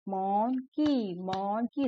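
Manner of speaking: slow monkey, split as mon, kee; broken into syllables. Shown as a demonstration. Teacher clapping along for children, slow syllable-by-syllable monkey. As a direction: clap out monkey one syllable at a time. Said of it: "इस तरीके से clap करते करते".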